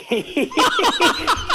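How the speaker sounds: sudden high laugh; a big real laugh; burst of laughter. Real, not acted. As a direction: laugh out loud at the memory. Laughing at the dad story.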